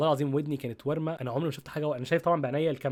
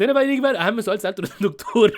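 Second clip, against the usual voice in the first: loud, raised voice breaking into laughter; laughing; 6 semitones higher, 9 dB louder than usual. Real, not acted. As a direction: laugh while setting up the funny question. Laughs recalling question to doctor.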